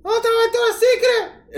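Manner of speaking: high Puerto Rican tourist voice; puerto rican accent. Retold or imitated. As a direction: imitate a lost Puerto Rican tourist asking. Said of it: "did your Puerto Rican accent come out?".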